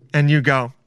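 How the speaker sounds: ordinary delivery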